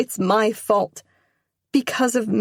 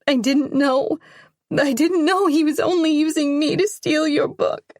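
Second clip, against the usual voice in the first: higher and louder, crying through it; sobbing apology; 9 semitones higher, 5 dB louder than usual. Acted. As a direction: beg forgiveness through tears. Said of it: "Sobs rack my shoulders".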